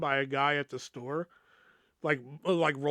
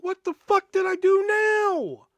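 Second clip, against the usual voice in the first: high, loud and slow, an exasperated yell; annoyed outburst; 13 semitones higher, 5 dB louder than usual. Retold or imitated. Summoned upstairs yet again, he yells in annoyance. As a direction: bellow it like he's fed up with being called up again. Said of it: "Like, now I'm fucking annoyed".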